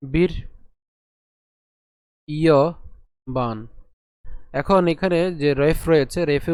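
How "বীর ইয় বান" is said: বীর, ইয়, বান with long pauses between; broken into syllables. Shown as a demonstration. Teacher breaking the word up, বীর ইয় বান in isolated chunks. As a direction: say বীর ইয় বান as three separate pieces. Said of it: "একে ভেঙে উচ্চারণ করি".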